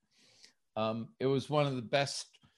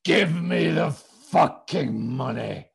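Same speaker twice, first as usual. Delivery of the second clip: slow, loud threatening growl; menacing demand; 5 semitones higher, 10 dB louder, slower than usual. Retold or imitated. Enforcer shoving his face in, slow and loud threat. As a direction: lean in and threaten him. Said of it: "and said".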